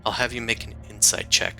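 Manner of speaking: usual speaking voice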